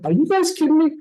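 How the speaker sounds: high-pitched mock exasperation; incredulous. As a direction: replay disbelief at being pranked. Acted reaction to prank.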